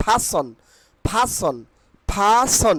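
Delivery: "person" said PHA-son, r dropped; breathy p in "person", as pha-son; corrected pronunciation. Shown as a demonstration. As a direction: say "person" with puffed p and no r. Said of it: "correct pronunciation will be".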